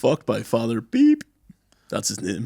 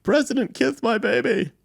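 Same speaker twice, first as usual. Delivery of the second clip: much higher, slow squeaky pleading voice; hammed-up begging parent voice; a mother begs the president to kiss her baby; mock baby-kisser plea; 12 semitones higher, slower than usual. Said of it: "be like".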